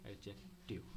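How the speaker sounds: Adjective low and soft, broken before टिव; dictating in pieces. Shown as a demonstration. Teacher writing the word for students, dictating in pieces. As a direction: murmur adjective in two separated pieces, as if writing.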